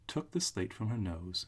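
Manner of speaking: ordinary delivery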